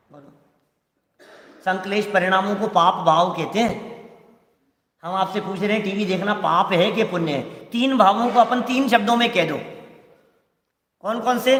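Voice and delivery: ordinary delivery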